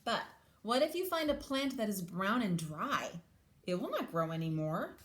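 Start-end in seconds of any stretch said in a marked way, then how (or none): none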